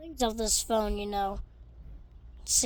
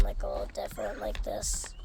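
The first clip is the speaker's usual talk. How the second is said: low, breathy robot voice; whispery robotic allergy voice; 12 semitones lower, 6 dB quieter than usual. Shown as a demonstration. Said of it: "Kinda sounds like a robot".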